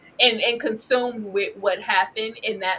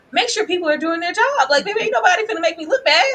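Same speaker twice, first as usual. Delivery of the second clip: high, loud mock diva mother; sassy mother voice; 9 semitones higher, 6 dB louder than usual. Recapper mimicking a diva mother, higher and louder. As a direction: play the bossy mama, pitched up. Voicing Evelyn's bossy mother talk.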